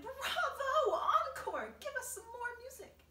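high, loud cheering cry; excited shout. A narrator's reading. Miss Spider cheering the grasshopper's music, excited high shout. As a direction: call out for an encore at full voice. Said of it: "stood up and shouted".

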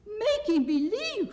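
very high, slow incredulous question; shocked cry. A narrator's reading. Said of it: "cried Dorothy".

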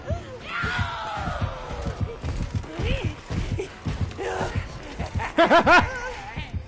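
squealing high laugh; delighted shrieking cackle. Real, not acted. Laughs after teammate found.

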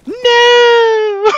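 very high, loud cry; anguished shout. Real, not acted. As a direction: wail at the failure.

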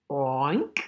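low oink sliding up; pig oink. Acted. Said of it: "And the pig said".

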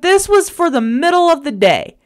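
exasperated. Retold or imitated. Retelling argument with boyfriend.

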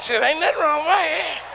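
lightly voiced defensive friend; [name]'s voice. [name] answering, shaking head.

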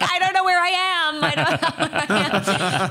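high, laughing disbelief through the words. Retold or imitated. Telling American fans about her accent, laughing. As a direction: laugh it out, mock bewildered.

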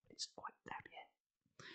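very quiet, slow whisper; hushed little aside. Pretend. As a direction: whisper it slowly under your breath. Whispered aside after reading gasp.

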